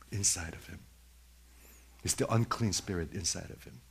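ordinary talk, nothing marked

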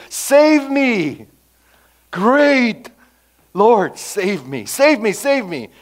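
high, loud cry for mercy; desperate pleading. Retold or imitated. Preacher voicing Jonah crying from the fish for mercy. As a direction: beg like a drowning man. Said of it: "There he was a seeking for mercy".

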